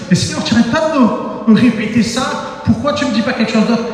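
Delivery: high-pitched, mock-exasperated pleading; exasperated protest. Man complaining to God who keeps repeating himself, exasperated.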